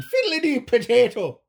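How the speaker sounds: loud, high cartoon Irish accent; hammed-up Irish squeal; screamed mock Irish. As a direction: shriek it like a silly Irish caricature. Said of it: "they're screaming".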